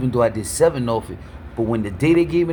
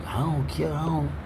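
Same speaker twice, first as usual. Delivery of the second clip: a quiet imitation of someone who couldn't care less; careless dismissive mumble; 2 semitones higher, 6 dB quieter than usual. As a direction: mimic people who shrug off caring, muttering. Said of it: "and people always".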